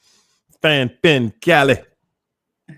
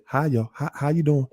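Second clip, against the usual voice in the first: soft greeting; 7 dB quieter, faster than usual. Retold or imitated. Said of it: "you gotta scream it".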